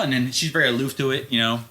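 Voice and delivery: normal talking voice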